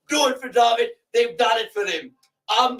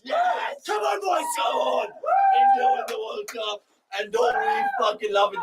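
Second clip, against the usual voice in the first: far higher, shrieked cheers; screaming with joy; 3 dB quieter, slower than usual. Real, not acted. Fan screaming at the team as India win, overjoyed screaming.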